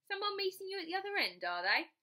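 a lightly voiced suspicious guard; nosy ticket collector. Ticket collector questions Bess.

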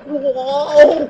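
loud, high mock yelp of surprise; mock startled whoa. Retelling his own startled yelp at the soldier, hammed up. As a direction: replay your own jump in fright. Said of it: "and I'm like".

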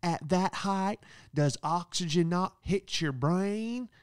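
high, slow mocking woman's voice; roasting lady. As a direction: sneer at him like a rude old guest. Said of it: "she goes".

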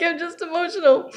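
imitating her own tearful voice; teary high voice. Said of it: "I'm like".

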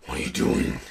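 low, breathy creepy greeting. Retold or imitated. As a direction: lurk and murmur it low. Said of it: "creepy ass fucking things I do".

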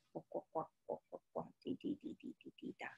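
hushed, breathy croaks and drips; whispered frog croaks. Acted. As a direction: whisper the frogs like a secret. Whispered frog and rain sounds.

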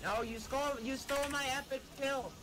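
loud, high outraged protest. Real, not acted. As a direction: cry out at the stolen kill. Kill stolen, outraged.

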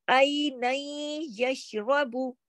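"आई नई यशरबु" very slowly, with a long nasal y; idgham recitation. Shown as a demonstration. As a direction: draw out "आई नई यशरबु", merged at the y. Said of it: "आई नन नहीं पढ़ेंगे".

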